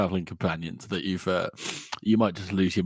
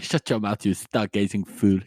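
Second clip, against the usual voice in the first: put-on French accent, slowing on the insult. Pharaoh telling off a stargazer, in a French accent. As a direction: scold in an imitated French accent. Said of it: "You're talking in a French accent".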